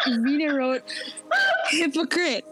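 giggling through the words; laughing. Real, not acted. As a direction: laugh while saying it. Laughs while telling story.

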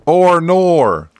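high, loud mock Aussie "oh no"; hammed-up Australian cry; mock Australian accent. Co-host topping the joke, hammed-up Australian accent. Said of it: "pretty much just how we sound".

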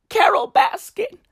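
high breathy whisper of the name. Acted. Vlogger to fans, whispering the name. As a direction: hiss the name like a secret.

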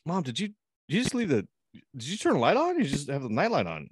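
put-on high-pitched questioning kid; stepson. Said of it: "my stepson goes".

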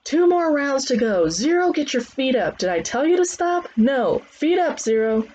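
high-pitched drill calls; loud trainer's commands. Acted. Trainer correcting Zero during rounds, high shouted commands. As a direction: call out orders to the whole gym. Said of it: "Trainer's voice hurt my ears".

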